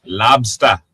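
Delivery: "lobsta" with the final R dropped; dropped-R lobster. Shown as a demonstration. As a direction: end lobster on an open "ah", punched. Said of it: "You gotta say it right".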